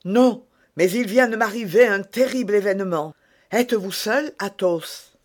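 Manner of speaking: urgent and shaken. A narrator's reading.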